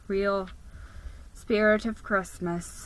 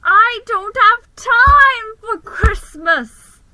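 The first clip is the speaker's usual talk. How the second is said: high, slow anguished cry; desperate drawn-out shout; frantic outcry; 13 semitones higher, 12 dB louder, much slower than usual. A narrator's reading. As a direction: wail it out, stretching every word. Frantic outburst at work.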